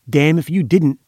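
snarling. A narrator's reading. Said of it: "a voice snarled at his side".

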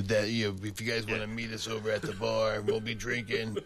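scraggly smoker DJ voice; smoker radio voice. Retold or imitated. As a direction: rasp it like a smoky DJ. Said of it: "It's like".

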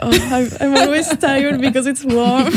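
mock complaint broken by a giggle; laughing self-quote. Guest quoting herself to host about the heat, laughing. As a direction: imitate your own complaint, laughing.

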